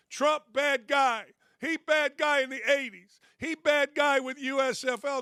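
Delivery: high, sing-song mock caveman talk; mocking simpleton. Radio host mocking a writer's Trump takes, mock-simpleton.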